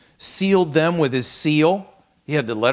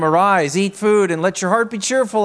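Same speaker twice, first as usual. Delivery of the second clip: commanding; 7 semitones higher, 7 dB louder than usual. Acted. Jezebel commands Ahab.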